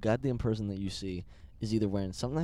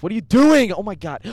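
sudden loud, high yell at the driver; alarmed shout; 11 semitones higher, 10 dB louder than usual. Real, not acted. Passenger yelling at a driver's wrong turn, alarmed shout.

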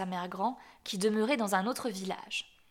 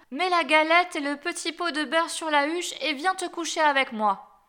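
higher, louder coaxing granny voice; raised-voice disguised wolf; wolf posing as granny; 7 semitones higher, 6 dB louder than usual. A narrator's reading. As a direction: coax the child as a fake grandmother.

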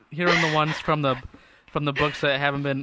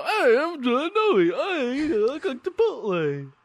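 much higher, slow, laughing imitation; mock John Noe; 11 semitones higher, slower than usual. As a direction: ham up a squeaky goofy host. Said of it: "that's exactly what you sound like".

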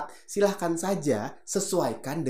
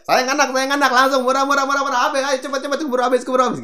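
high, loud laughing sales call; street hawker; 11 semitones higher, 7 dB louder, faster than usual. Retold or imitated. Teacher imitating a street seller after Friday prayer, loud hawking. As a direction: shout the bargains like a market seller. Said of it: "yang biasa jualan kalau kita habis sholat Jumat".

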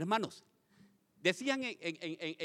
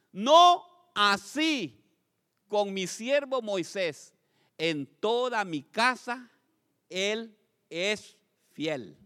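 drawn out, slow and booming; the Lord speaking slowly about Moisés, solemn and grave; solemn voice of God; 4 semitones higher, 8 dB louder, much slower than usual. Acted. Reading God's words solemnly.

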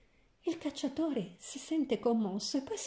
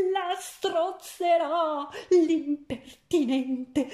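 much louder and higher, slow, with a mad laugh; furious shout; 6 semitones higher, 6 dB louder, much slower than usual. A narrator's reading. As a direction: scream out the threat, half laughing. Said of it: "e gridò".

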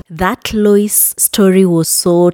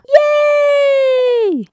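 playful yay. Acted. Host teasing episode title.

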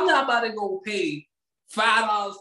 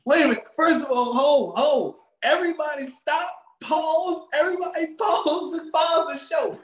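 outraged protest; 7 semitones higher than usual. Real, not acted. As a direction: halt everything, indignant. Halting the show in protest.

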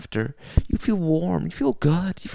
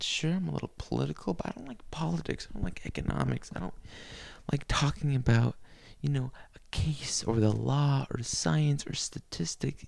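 usual speaking voice

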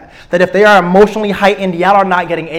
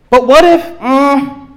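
mock objection; 6 semitones higher, slower than usual.

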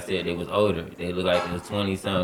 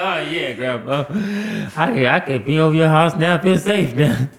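higher, louder mock cheer; animated, higher-pitched quote; relieved retelling; 7 semitones higher, 4 dB louder than usual. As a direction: play up the relief to grandma. Retold relief to grandma.

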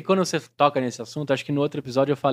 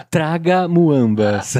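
slow mock airport announcement; drawn-out joke PA voice; 3 dB louder, much slower than usual. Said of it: "voz de aeroporto". (anúncio de aeroporto lento, de brincadeira)

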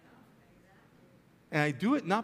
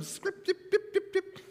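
higher, laughing dip dip dip; laughing mimicry; 12 semitones higher than usual. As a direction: count the dips while laughing. Miming the dipping of louvers.

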